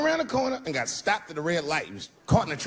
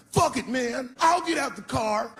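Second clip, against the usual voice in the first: cocky bro; 8 semitones higher, slower than usual. Retold or imitated. Cocky guy voiced.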